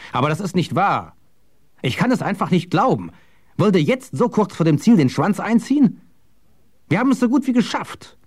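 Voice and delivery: high-pitched, incredulous outburst; indignant protest. A narrator's reading. Matthias protesting that they nearly made it, indignant. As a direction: jump in, pleading disbelief, pitch climbing.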